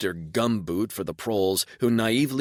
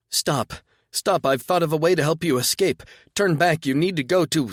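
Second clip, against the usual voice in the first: high loud calling out; desperate shouting; 9 semitones higher, 7 dB louder than usual. Acted. Mocha shouting to him to stop, high and loud. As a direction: shout after him to turn back.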